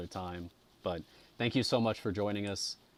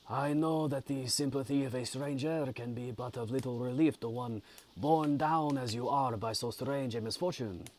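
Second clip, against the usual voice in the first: higher-pitched, very bad French accent; Mister Kirwin; 5 semitones higher than usual. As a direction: sympathetic stranger in a clumsy put-on French accent. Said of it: "That's a very bad accent".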